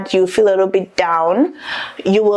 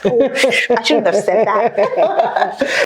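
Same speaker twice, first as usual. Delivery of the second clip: laughing; much slower than usual. Real, not acted. Lets slip a secret.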